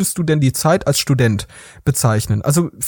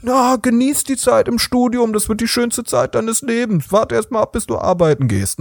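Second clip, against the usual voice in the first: mock academic parents; 6 semitones higher than usual. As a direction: lecture like a smug academic parent. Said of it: "bei deinen Akademikerfamilien".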